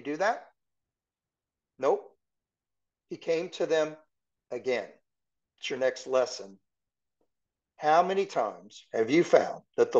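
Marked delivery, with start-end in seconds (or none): none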